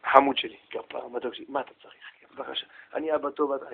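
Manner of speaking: low, very soft endearments; tender father. Acted. Father interrupted while learning Torah answers son, tenderly. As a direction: answer the child gently and patiently.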